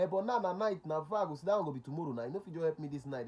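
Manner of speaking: high, loud mock pleading; insistent caller. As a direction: act out a caller pressing urgently for help.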